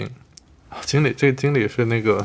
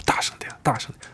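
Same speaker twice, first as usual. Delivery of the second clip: hushed, voiceless prompt; imitated whispered tip; whispered reminder; 4 dB quieter than usual. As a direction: whisper it discreetly to a neighbour. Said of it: "小声提醒我说".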